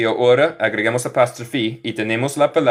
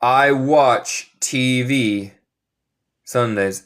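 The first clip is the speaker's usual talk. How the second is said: slow model sentence; much slower than usual. Shown as a demonstration. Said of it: "¿Qué necesitamos aquí en inglés?".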